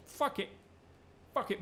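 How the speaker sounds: sudden high, defiant fuck it; defiant outburst. Pretend. Gamer deciding to wing it, a high defiant outburst.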